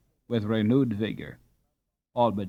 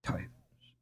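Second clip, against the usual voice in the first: whispered Dutch oath; quick voiceless Dutch oath; Dutch exclamation; 15 dB quieter than usual. Acted. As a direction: breathe out a German-Dutch curse. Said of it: "retorted Jacob".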